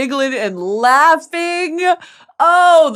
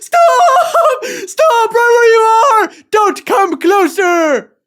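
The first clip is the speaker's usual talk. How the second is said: high, loud, panicked yell; panicked shout; 12 semitones higher, 7 dB louder, much slower than usual. Retold or imitated. Warning a fan away from the tube, shrieking. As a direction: halt them with a frantic cry. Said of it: "I'm like".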